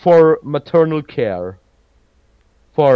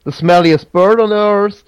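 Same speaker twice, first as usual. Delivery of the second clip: brisk, higher-pitched quick recap; a bit faster; 5 semitones higher than usual. Real, not acted. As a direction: run through the option more quickly. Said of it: "a little bit faster".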